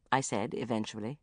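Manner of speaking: ordinary delivery, nothing marked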